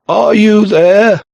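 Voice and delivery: high, slow, loud telephone call; shouting. A narrator's reading. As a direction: shout down a bad line. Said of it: "realized that he'd been shouting".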